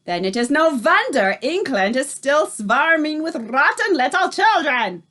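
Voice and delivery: Grand High Witch thundering at her coven. A narrator's reading. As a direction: thunder out your disgust. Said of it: "thundered the Grand High Witch".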